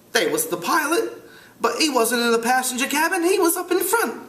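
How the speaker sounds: high British schoolboy voice; British accent. Acted. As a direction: play a piping British boy. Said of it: "try to do it with a British accent".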